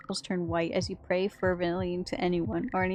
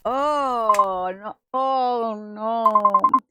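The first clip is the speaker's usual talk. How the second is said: rising, loud horrified shock; real disgusted shock; reacting in horror to the story; 4 semitones higher, 8 dB louder, much slower than usual. Real, not acted.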